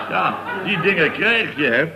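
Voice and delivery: chuckling through the words; laughing. Real, not acted. A man retelling his near fall, laughing. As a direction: laugh as you shrug it off.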